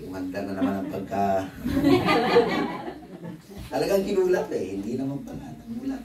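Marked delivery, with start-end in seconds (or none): 1.5-3.7 laugh. Real, not acted. Preacher laughing at the story.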